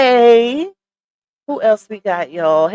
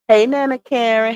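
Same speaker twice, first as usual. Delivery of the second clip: normal talking voice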